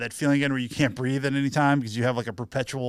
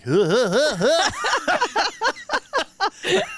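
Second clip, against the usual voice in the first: loud high raspy clown laugh; Krusty laugh; 14 semitones higher, 4 dB louder, much slower than usual. Retold or imitated. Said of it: "it sounds like Krusty the Clown".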